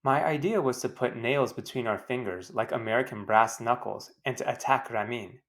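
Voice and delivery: ordinary talk, nothing marked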